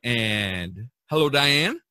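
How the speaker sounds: usual speaking voice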